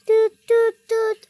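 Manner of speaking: high, loud "টুট টুট" imitating a dial tone; phone hang-up beeps. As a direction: chirp the hang-up tone like a toy phone. Hangs up pretend phone.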